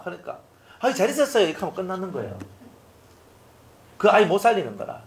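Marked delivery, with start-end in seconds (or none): 0.8-1.5 high, lightly voiced neighbour calling out hello; a hint of an eager acquaintance stopping you on the road; mock cheerful hello from a passerby; chatty passerby greeting. Servant answers the greeting.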